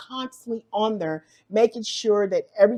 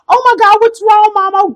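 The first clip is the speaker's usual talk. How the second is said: high, loud startled question; startled, worried call back; alarmed; 12 semitones higher, 9 dB louder than usual. Retold or imitated. Son alarmed that his mother is calling.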